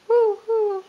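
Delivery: high, hooting owl calls; playful owl hoot for a toddler. Pretend. Parent voices owl for toddler.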